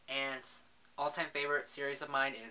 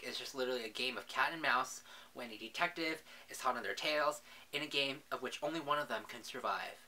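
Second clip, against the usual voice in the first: ordinary talk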